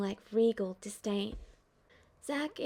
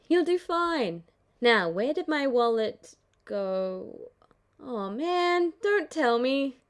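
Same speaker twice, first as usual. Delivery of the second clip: louder, sudden dismay; dismayed Zack; 2 semitones higher, 5 dB louder, slower than usual. Acted. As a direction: realize the wallet is gone. Zack realizing lost wallet.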